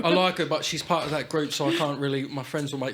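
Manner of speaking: London-tinged imitation of a popular lad; attempted London accent. Popular boy confessing a crush to friends, London-tinged accent. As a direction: put on a slightly London lad's accent. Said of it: "you've gone London there".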